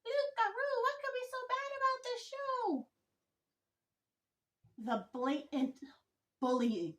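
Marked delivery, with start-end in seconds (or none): 0.1-2.9 much higher, different voice for the imagined viewers; high mock-viewer voice for the imagined audience. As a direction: put on the whiny voice of a viewer asking. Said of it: "I can just hear you guys now".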